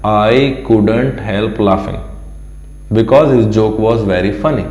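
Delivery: very slow, careful English reading; slow teacher read-aloud. Shown as a demonstration. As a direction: spell it out slowly for learners. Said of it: "teacher reads the English line slowly".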